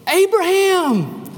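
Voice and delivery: high, slow, drawn-out call of the name; voice of God calling. Acted. God stopping Abraham's knife at the altar, slow high call. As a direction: call out from above, stretching each Abraham.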